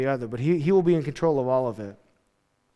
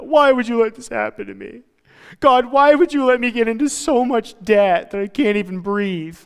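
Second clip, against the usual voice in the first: higher, whiny plea to God; complaining to God; 6 semitones higher, 4 dB louder than usual. Retold or imitated. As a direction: act out a self-pitying complainer. Person whining at God.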